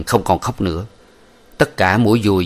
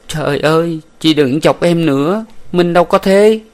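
distraught; 9 semitones higher, 4 dB louder than usual. A narrator's reading. Huyen distraught at betrayal.

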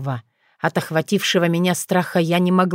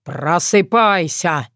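orc bass shout; slower than usual. A narrator's reading. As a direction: bellow it like a green giant. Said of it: "Громко прозвучал бас орка".

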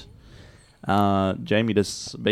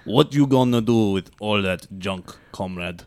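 Russian accent; 2 semitones lower than usual. Shown as a demonstration. Co-host models the lyrics for Jamie, Russian accent. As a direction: recite song words in heavy Russian accent. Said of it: "You're doing Russian today".

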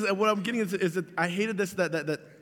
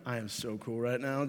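smug mutter; 6 semitones lower, 6 dB quieter than usual. Retold or imitated. Kid acting cool in class.

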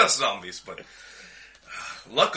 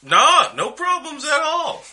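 much higher, exaggerated denial; high-pitched reassurance; 5 dB louder, slower than usual. Player placating the challenged cousin, high exaggerated voice.

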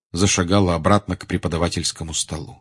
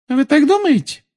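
very high, louder teacher's retort; cold challenge; 6 dB louder than usual. A narrator's reading. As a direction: challenge the pupil in a raised, high voice. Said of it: "перестала говорить шёпотом и выпрямилась". (холодный вызов высоким громким голосом)

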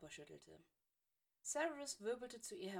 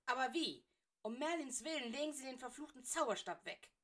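louder, impatient command; mildly raised stern teacher's voice; sharp order; 5 semitones higher, 5 dB louder than usual. Acted. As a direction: snap the order at the fool. Severus barks an order.

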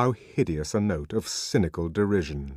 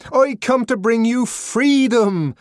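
high, loud, slow oration; ringing, impassioned announcement; proclamation; 9 dB louder, slower than usual. A narrator's reading. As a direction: declaim it to a crowd. Savage proclaims freedom to the crowd.

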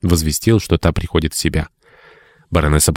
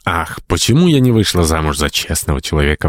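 ordinary delivery, nothing marked

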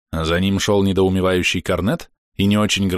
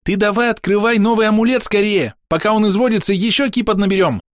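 high, hurried-sounding little voice; bossy little cricket helper; chirping cricket, urgent; 13 semitones higher, 3 dB louder than usual. A narrator's reading. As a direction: urge him on excitedly.

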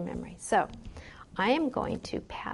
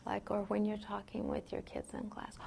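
softer; 11 dB quieter than usual. Shown as a demonstration. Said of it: "is this how you talk".

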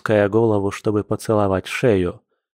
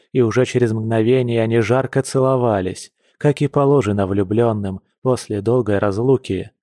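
ordinary delivery, nothing marked

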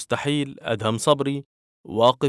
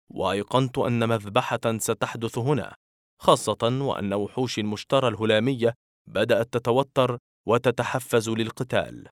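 usual speaking voice

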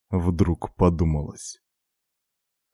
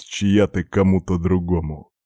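ordinary delivery

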